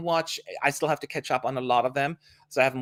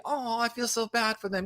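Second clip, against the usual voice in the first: mock pity; 8 semitones higher, 4 dB quieter than usual. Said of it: "We all do that".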